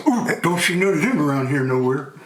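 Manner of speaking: frog talking through a tiny, pursed mouth; small-mouthed frog playing innocent; closed-mouth frog. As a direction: shrink the mouth small to hide the wide mouth. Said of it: "He closed his mouth".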